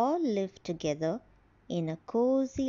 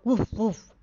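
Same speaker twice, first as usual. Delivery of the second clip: dog bark; 6 dB louder than usual. Acted. Dog barks.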